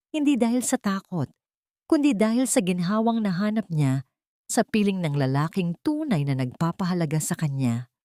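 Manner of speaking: usual speaking voice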